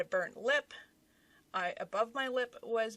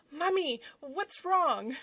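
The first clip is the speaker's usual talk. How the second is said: higher-pitched imitation of a worried child; concerned little boy; 7 semitones higher, slower than usual. Six-year-old son finds mom crying, concerned child voice. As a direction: play a small son asking after mum. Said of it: "My six-year-old comes down the stairs".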